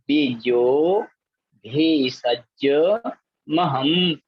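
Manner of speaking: each word drawn out slowly and repeated; teacher drilling verse words; slow word-by-word recitation. Shown as a demonstration. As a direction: model each word for the class to echo. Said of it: "दूसरी पंक्ति देखें".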